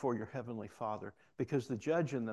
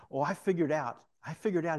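louder, excited retelling of a revelation; animated; 4 semitones higher, 6 dB louder than usual. Retold or imitated. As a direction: imitate someone eagerly announcing a discovery. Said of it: "he becomes so animated".

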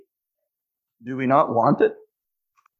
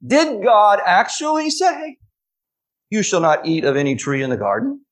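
crafty serpent; 8 semitones higher than usual. A narrator's reading. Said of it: "And he said to the woman".

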